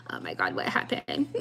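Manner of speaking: mock lost voice with laughter; laughing dazed wail. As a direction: fake bewilderment while cracking up. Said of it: "we're like".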